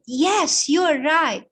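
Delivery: loud sudden agreement; queen exclaiming to her maid that she is right; exclaimed. Acted. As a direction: cry out as the truth dawns. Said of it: "she exclaimed".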